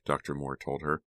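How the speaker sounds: usual speaking voice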